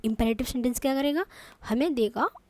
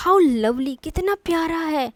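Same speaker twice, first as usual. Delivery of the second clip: "How lovely" called out louder, with "How" rising; delighted exclamation; 4 semitones higher, 5 dB louder, slower than usual. Shown as a demonstration. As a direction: gush over something cute. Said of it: "feeling को express कर रहे हो". ("How lovely" ज़ोर से और खुशी से, "How" ऊपर उठाते हुए)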